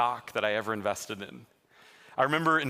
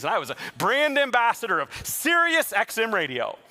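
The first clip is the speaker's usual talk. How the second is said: excited; 9 semitones higher, 5 dB louder than usual. Retold or imitated. Proud brand ambassador boast.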